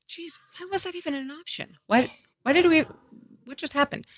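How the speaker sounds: high-pitched, mostly hushed dismay; retold disbelief. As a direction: question it in a high, small voice. Retold dismay at surgery news.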